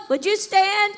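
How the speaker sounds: ordinary delivery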